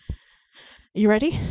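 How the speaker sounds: slow, loud rising hype. Real, not acted. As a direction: pump him up slowly. Host hyping up partner.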